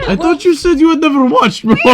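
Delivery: gleeful gotcha through laughter; laughing. Real, not acted. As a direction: player catches the GM's slip and laughs at him. Catching the GM out.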